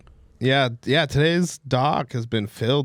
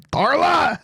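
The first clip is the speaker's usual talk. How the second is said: very high comic yell; mock holler; 4 dB louder than usual. Canadian character calling out to Darla, mock holler. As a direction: holler the name, pitch shooting up.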